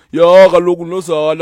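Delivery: put-on loud, playful Xhosa accent. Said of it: "my Xhosa accent just go".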